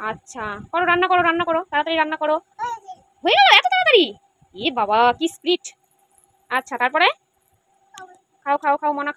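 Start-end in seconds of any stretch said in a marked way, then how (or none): 3.2-4.0 high, loud playful surprise; mock surprise. Mother to child in pretend play, playfully amazed. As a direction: act amazed that it is already done. Pretend cooking finished too fast.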